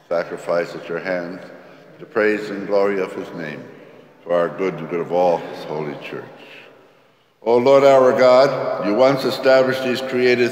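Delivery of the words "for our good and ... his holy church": normal talking voice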